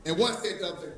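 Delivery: usual speaking voice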